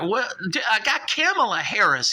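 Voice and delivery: high indignant what; shocked. Acted. Shark stunned when Elon calls him unintelligent, high shocked. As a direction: yelp in disbelief.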